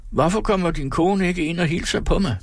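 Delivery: high-pitched demanding call; calling after him. A narrator's reading. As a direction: call out a demanding question, high all through. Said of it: "råbte søsteren efter ham". (råber efter ham i højt toneleje)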